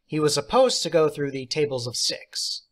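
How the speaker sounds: ordinary delivery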